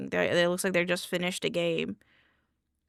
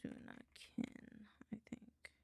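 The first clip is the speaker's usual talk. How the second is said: whisper; 24 dB quieter than usual. Real, not acted. Muttering to herself.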